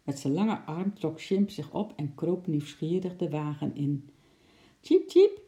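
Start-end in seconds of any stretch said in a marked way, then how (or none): 4.8-5.4 very high, loud little chirp; impatient squeak. Acted. Eki wanting up into the van, squeaking impatiently. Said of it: "piepte Eki ongeduldig".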